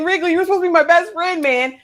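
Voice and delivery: high, loud, rushed mock accusation; acted outburst. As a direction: act out a hurt, indignant yell at a friend. Acting out a bitter confrontation.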